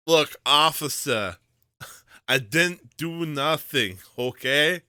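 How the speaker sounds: slow loud guilty plea; pleading suspect. Pretend. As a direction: play the guilty kid pleading. Pretend pleading with officer.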